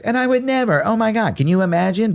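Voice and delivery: high, squealing mock horror; hammed-up pearl-clutching gasp at the very idea; mock shocked innocence. Comic telling wife he'd never, in high mock horror. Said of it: "and I was like".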